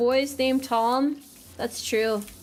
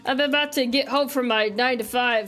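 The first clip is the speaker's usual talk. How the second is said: much lower, tired office-dad voice; hammed-up lower middle-aged man voice; fake real voice; 4 semitones lower than usual. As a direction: pretend your true voice is a weary working man. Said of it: "Sorry, my voice changer broke for a moment".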